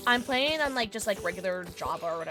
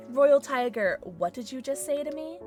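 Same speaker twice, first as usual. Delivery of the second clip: usual speaking voice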